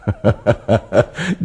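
short amused laugh rising. Acted. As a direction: laugh at his stubborn devotion. Amused by Felmerayer's devotion. (hayranlıkla gülüş)

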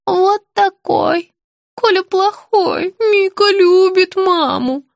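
put-on high, slow, childlike pleading; confused old woman. A narrator's reading. Sick woman begging to see her child, confused high voice. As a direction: plead slowly in a high little voice.

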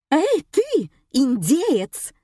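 high, indignant holler; acted high-pitched yell of an angry diner. A narrator's reading. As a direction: holler at someone across the room. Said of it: "завопила любительница сырого мяса".

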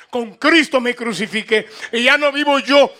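ordinary talk

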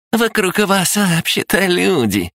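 передразнивая: пискляво, высоко, медленно; кривляясь, тонким голоском; писклявый голос. Acted. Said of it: "писклявым голосом". (писклявое передразнивание)

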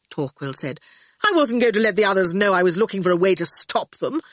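put-on high, quick, sulky older boy; moody Torquil. A narrator's reading. Torquil defending himself to Howard, quick and sulky. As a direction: pour out the grievance in a rush.

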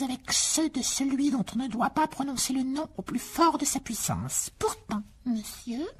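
high, slow house-elf voice; slow, high, earnest elf; house elf Dobby. Acted. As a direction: squeak it out like an earnest little elf.

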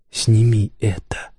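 slow, strained rasp, held back; rasped, weakened demand; hoarse croak. A narrator's reading. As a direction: croak out the plea with effort. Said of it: "прохрипел Кел".

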